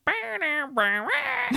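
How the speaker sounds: high, bad vocal guitar wail; mock guitar solo. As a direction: ham up a terrible guitar solo with your voice. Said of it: "It's horrible".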